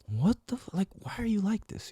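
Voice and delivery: high, soft puzzled voice; a friend gently baffled by his tough act; puzzled older friend. Retold or imitated. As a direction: ask it softly, baffled, in a lifted voice. Mentor's puzzled question voiced.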